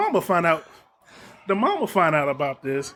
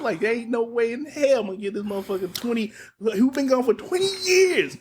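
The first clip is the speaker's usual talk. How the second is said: heated rant; 7 semitones higher than usual. Real, not acted. Angry rant about the father.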